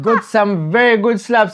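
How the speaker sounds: normal talking voice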